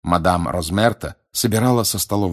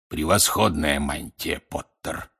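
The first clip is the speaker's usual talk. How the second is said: slow, low croak fading softer; hoarse rasp; 5 semitones lower, 4 dB quieter, slower than usual. A narrator's reading. Moody leans over to the hidden Potter, rasping low. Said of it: "прохрипел Грюм".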